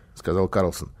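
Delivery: ordinary delivery, nothing marked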